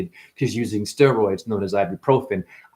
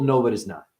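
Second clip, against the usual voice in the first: ordinary delivery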